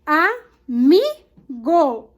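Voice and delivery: "amigo" very slowly in separate syllables, MI strongest; stress on MI. Shown as a demonstration. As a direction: break "amigo" into A-MI-GO, punching MI. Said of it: "O mi é a sílaba tônica". ("amigo" sílaba por sílaba, com força no MI)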